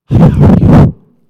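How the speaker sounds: breathy whisper right into the mic; close-mic whisper. Shown as a demonstration. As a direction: lips on the microphone, whispering. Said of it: "invade your intimate space by using a microphone like this".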